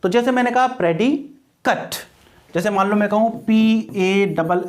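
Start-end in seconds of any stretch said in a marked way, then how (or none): 0.7-1.9 "प्रेडिकेट" split before its short "-kut" ending; noun ending demonstrated. Shown as a demonstration. Teacher gives an example of the rule, "प्रेडिकेट" ending "-kut". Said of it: "उसको क्या बोलते हैं?".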